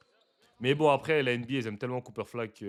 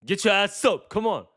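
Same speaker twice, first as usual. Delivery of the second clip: mock trash talk; 8 semitones higher, 8 dB louder, slower than usual. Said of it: "ça trash talk".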